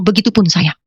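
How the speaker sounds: ordinary delivery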